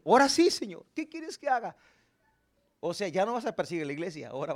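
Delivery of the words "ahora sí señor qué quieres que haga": higher, eager submissive plea; eager surrender. Acted. Saul surrendering to the Lord, high and eager. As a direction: offer yourself up eagerly, pitched high.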